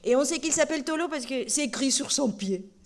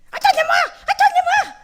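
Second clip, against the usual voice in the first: very high, loud little puppet calling out; high puppet shout; 11 dB louder than usual. Acted. Puppet Tolo calls from the bag, loud high shout. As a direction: shout from inside the bag as a tiny creature. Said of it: "c'est qui qui crie comme ça".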